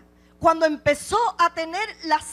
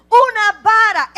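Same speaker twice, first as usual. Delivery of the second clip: much louder, high, with "Una" punched; shouted answer; 5 semitones higher, 11 dB louder than usual. Acted. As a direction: drive it home harder. Answer repeated with rising force. ("Una vara" gritado, golpeando "Una")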